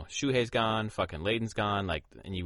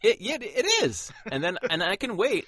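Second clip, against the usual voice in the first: excited; 12 semitones higher, 6 dB louder than usual. Real, not acted. Excited agreement.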